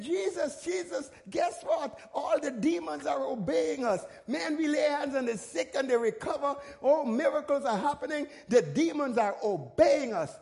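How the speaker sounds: high, eager report of good news; bursting to tell Jesus about the miracles; excited disciples. Retold or imitated. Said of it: "came back to Jesus all excited".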